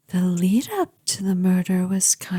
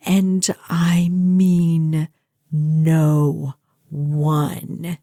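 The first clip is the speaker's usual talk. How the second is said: possessive menace; 4 semitones lower, slower than usual. Acted. Possessive threat to the captive.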